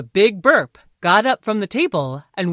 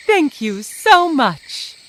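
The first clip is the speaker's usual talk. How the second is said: slow heartfelt thank you; grateful slow thanks; 7 semitones higher, 6 dB louder, slower than usual. Acted. Grateful ogres.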